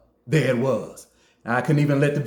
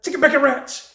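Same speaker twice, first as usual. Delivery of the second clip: high, blurted order; hollered order; 10 semitones higher than usual. Retold or imitated. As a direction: blurt it out under pressure. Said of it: "But I just hollered".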